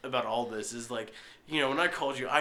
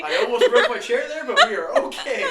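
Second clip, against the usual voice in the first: laughing hard; 10 semitones higher, 9 dB louder than usual. Real, not acted. Nearly fell off chair, laughing.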